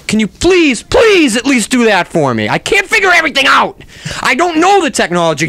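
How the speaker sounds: high, strained frustrated begging; overwhelmed, voice pushed way up high; exasperated pleading. Real, not acted. Host begs helper in frustration.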